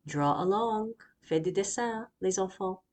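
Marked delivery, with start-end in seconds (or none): none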